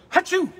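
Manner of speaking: big loud mock sneeze; fake sneeze. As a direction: sneeze hard into your hand. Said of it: "you walk in and go".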